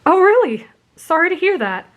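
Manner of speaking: high, surprised apology; apologetic. A narrator's reading. Boy apologizes about the drink, high and apologetic. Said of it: "Izuku apologized".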